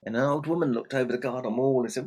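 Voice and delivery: usual speaking voice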